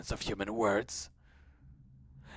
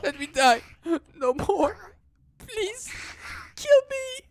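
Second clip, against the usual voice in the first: much higher, louder wailing plea; crying creature plea; 7 dB louder, slower than usual. Acted. Creature begging Koji to kill it, crying out high. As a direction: wail the begging as if in agony. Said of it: "not a human voice".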